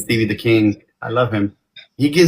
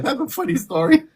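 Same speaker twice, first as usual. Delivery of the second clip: voicing Mel; 12 semitones higher than usual. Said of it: "She's like".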